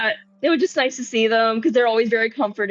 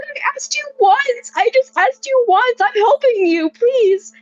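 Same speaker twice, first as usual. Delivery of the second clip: very high and louder, near tears; desperate pleading; 12 semitones higher, 5 dB louder than usual. Retold or imitated. [name] retelling his pleas to the yelling Gongo, high and desperate. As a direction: beg him frantically. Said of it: "And I'm like".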